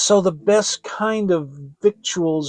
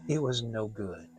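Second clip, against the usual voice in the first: usual speaking voice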